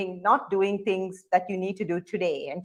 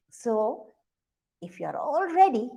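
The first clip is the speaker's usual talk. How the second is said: ordinary delivery, nothing marked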